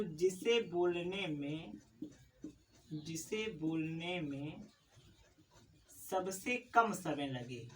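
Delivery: each phrase said slowly, with long pauses between; slow dictation. Shown as a demonstration. Teacher dictating definition to students, slow and spaced. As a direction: spell the definition out word by word for the class to copy. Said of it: "teacher dictating definition slowly".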